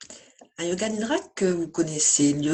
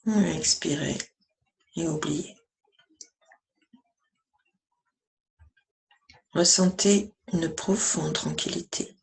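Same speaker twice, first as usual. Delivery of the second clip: even, measured meditation voice with long pauses; guided meditation. Acted. Yoga teacher guiding listeners lying down through yoga nidra, calm meditation voice.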